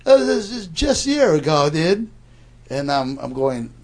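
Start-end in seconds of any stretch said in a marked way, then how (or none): 0.1-2.1 laid-back dude voice. Retold or imitated. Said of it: "He goes".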